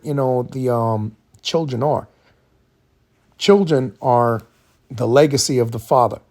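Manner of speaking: ordinary talk, nothing marked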